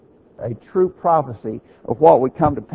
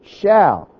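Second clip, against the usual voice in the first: Shall said alone, higher and louder; stress on Shall; 4 semitones higher, 5 dB louder than usual. Shown as a demonstration. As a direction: hit the word Shall hard, then stop. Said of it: "Emphasis on this last part".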